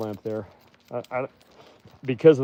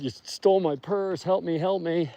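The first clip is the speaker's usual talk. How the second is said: imitated screaming; 7 semitones higher than usual. Retelling a stranger screaming about a stolen purse, imitated screaming. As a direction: mimic a distressed shout without the volume. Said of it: "now they're screaming about".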